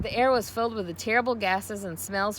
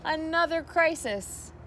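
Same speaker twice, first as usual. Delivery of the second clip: slow, higher weary groan; 8 semitones higher, slower than usual. A narrator's reading. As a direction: sigh it out like a put-upon girl. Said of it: "groaned Fern".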